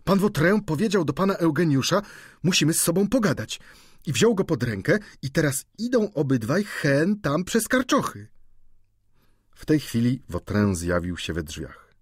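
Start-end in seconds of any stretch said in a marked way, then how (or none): 0.0-8.3 high, loud servant breaking news; excited cook Sylwia. A narrator's reading. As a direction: blurt the gossip out high and loud. (przejęta kucharka, wysoki podniesiony głos)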